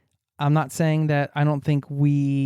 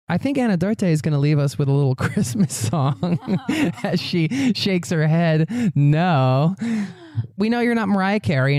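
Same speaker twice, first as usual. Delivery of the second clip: laughing; 3 semitones higher than usual. Real, not acted. Host narrating laughing guest.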